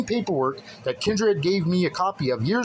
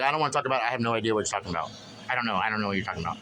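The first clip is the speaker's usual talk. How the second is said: imitating Kendra; 4 semitones lower, much faster than usual. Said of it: "she basically was like".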